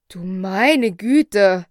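slow, loud cry of amazement; astonished exclamation. A narrator's reading. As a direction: exclaim it loudly, drawing it out. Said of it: "rief der Doktor aus".

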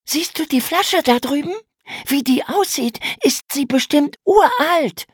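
viel höhere Mädchenstimme, begeistert; aufgeregt. A narrator's reading. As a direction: staunend und aufgeregt zeigen. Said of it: "Aufgeregt zeigte Emma auf ihren Fund". (Mädchen zeigt dem Bruder aufgeregt den Fund, viel höher)

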